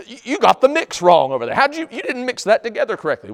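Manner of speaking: high, loud mock accusation; fighting. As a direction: play a worker shouting blame across the site. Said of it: "They're fighting".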